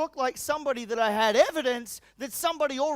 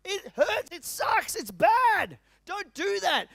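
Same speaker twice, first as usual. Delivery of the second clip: higher, pleading insistence; pained emphasis; 10 semitones higher, 5 dB louder, much slower than usual. Real, not acted. Speaker warning the room about faking it, pleading. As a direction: plead with them how much it hurts.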